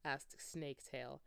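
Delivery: ordinary delivery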